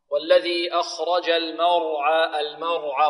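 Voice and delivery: slow, measured tajweed recitation with long held vowels; Quran recitation. Shown as a demonstration. Teacher models the verse for pupils, slow tajweed recitation. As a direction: draw out each syllable in an even chant.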